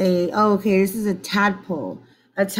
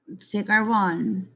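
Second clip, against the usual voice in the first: ordinary talk, nothing marked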